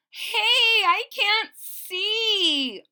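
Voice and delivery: high, loud, slow cry of alarm; high, loud cry of a kid who can't see. Acted. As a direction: call out blind under the sheet. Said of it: "cried Wilson".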